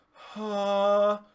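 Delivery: loud sung ha with a yawning open throat; touch of a yawn. Shown as a demonstration. Teacher demonstrating a ha with yawn feel. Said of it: "a touch of a yawn".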